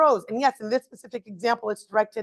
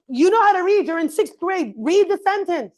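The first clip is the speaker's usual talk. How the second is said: higher, louder exasperated scolding; frustrated parent; 7 semitones higher, 4 dB louder than usual. Retold or imitated. Parent scolding a sixth-grader who reads choppily, exasperated. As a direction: scold the kid in disbelief. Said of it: "And you're going".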